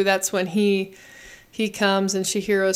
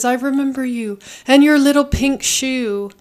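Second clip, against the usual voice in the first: lightly raised, louder mock of the dub line; 5 semitones higher, 4 dB louder than usual. Retelling the dub Haku line, mock dub voice. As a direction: poke fun at the English dub Haku.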